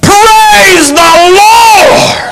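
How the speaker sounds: very high, drawn-out holler of praise; long praise shout. Retold or imitated. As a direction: howl it out loud, stretching every word. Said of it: "He's going".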